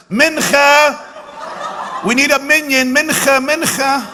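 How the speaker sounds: high, loud call to prayer, hammed up; mock calling out. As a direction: holler it out like rounding up passengers for prayers. Acting out a passenger calling prayer.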